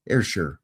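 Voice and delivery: "Ayrshire" said as "air-sher", first part like air, ending like sure; slow and careful: "Ayrshire" as air-sher; correct Ayrshire. Shown as a demonstration. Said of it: "It's pronounced Ayrshire".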